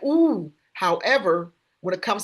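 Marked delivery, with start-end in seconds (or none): 0.0-0.5 knowing ooh. Retold or imitated. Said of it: "I'm like".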